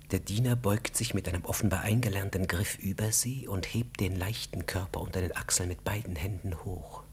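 normal talking voice

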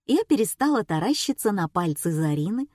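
ordinary delivery, nothing marked

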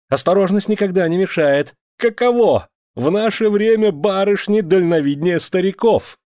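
high-pitched, heated sarcasm; worked-up, scornful outburst; angry jeering. A narrator's reading. Angry man jeers at her.

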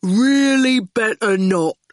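slow, heavy-witted thug, words dropped one by one; menacing henchman taking his time; slow lumbering thug; slow goon voice. Acted.

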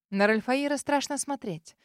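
ordinary delivery

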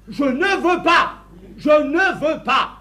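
cris lents, martelés, qui montent dans l'aigu; refus furieux. Acted. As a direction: marteler chaque mot du refus à pleine voix. Refuse catégoriquement la demande. (furieux, catégorique)

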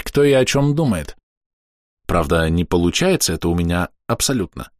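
ordinary delivery

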